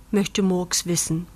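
ordinary delivery, nothing marked

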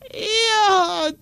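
put-on high, tearful little girl's voice; a child's wailing complaint, slow; crying girl. Girl accusing her cousin to the aunt, tearful. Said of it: "weint Käthe".

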